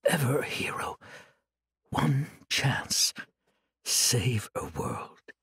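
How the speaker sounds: faint, lost whispering; delirious whisper. Acted. Chained monk whispering deliriously to himself, faint. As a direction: drift in and out of sense.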